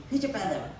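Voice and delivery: imitated Italian shopkeeper; light Italian-accented quote in a funny story. Musician imitating the shopkeeper asked about his brother, Italian accent. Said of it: "he says in Italian".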